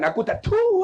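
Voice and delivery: high-pitched exasperated mock reply; comic helpless bewilderment; baffled protest. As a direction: imitate a flustered villager, voice shooting up. Baffled villager acted.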